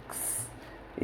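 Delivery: very soft, voiceless कस, just k into s; half-k plus s. Shown as a demonstration. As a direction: sound out कस as a bare ks with no vowel. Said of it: "क्या पढ़ते हैं?". (कस को आधा क और स जैसे, बिना आवाज़ के)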